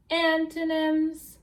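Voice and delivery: "Antonyms" loud, high, slow; modelled word. Shown as a demonstration. Teacher models "Antonyms" for class, loud and high. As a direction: draw out "Antonyms" big and high. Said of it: "Say that word".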